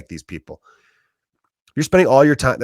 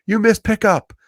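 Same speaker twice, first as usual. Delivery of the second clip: higher, whiny hurt child voice, lightly played; mock wounded kid; 10 semitones higher than usual. Dad imitating his kids' whiny complaint about a missed pickup. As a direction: play the kid's accusing whine. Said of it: "they're gonna be like".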